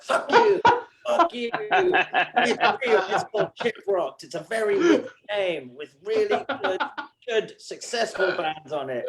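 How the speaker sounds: arguing with a stranger about the cruise, hammed-up rage; angry retort. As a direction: yell it back at the doubters. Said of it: "And you just argue with strangers".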